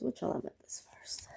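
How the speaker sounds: barely audible whisper; whispered question. Real, not acted. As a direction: ask it under your breath, almost to yourself. Repeats the question under her breath.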